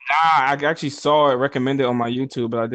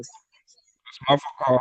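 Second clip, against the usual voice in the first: muffled mic; much slower than usual. Real, not acted. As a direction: voice muffled, like through a wall. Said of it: "it would sound like muffled ass".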